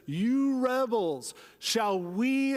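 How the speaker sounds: a touch of Moses losing his temper, dragging out rebels; angry rebuke. Retold or imitated. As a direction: Moses scolds the grumbling people in anger. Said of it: "and he says".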